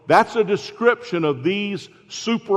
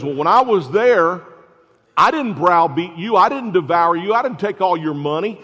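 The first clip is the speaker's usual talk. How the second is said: sarcastic; 4 semitones higher, 6 dB louder than usual. Retold or imitated. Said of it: "That's another sarcastic".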